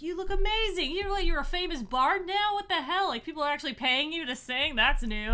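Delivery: high, loud, quickening amazement; excited friend. Acted. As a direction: gush at an old friend turned famous. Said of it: "Jacob's like".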